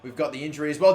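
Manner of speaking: ordinary talk, nothing marked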